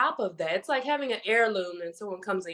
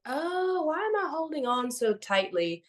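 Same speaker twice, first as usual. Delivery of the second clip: quoted inner realization, pitched up; retold self-questioning; 9 semitones higher than usual. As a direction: act out a sudden wondering to yourself in a raised pitch. Quoting own question from meditation.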